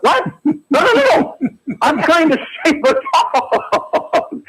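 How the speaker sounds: high-pitched, drawn-out comic pleading; exasperated protest. Retold or imitated. Caller retells defending his dog rescue to the woman, exasperated. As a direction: re-enact protesting your innocence, voice way up.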